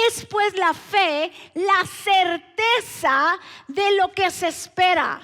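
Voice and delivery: versículo proclamado con fuerza, muy lento; proclamación enfática. Real, not acted. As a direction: declama cada frase, fuerte y espaciada. Proclama el versículo ante la congregación. (lectura bíblica solemne, a plena voz)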